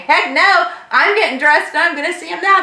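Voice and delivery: can't wait to get there; eager exclamation. Retold or imitated. Telling the store team she's going now, eager and excited.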